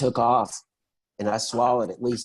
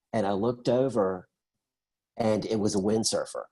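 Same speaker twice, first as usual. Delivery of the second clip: ordinary talk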